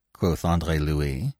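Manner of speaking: ordinary delivery, nothing marked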